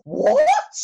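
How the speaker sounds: very high, loud shocked scream. Acted. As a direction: shriek it in royal outrage. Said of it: "screamed Queen Bee".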